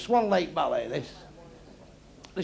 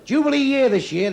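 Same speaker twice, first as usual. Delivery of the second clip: club announcement; 7 semitones higher, 7 dB louder than usual. Real, not acted.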